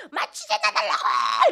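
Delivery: loud, slow, crying demand; retold yell in pain. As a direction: yell at the nurse through the pain. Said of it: "간호사한테 막 소리 지르는데".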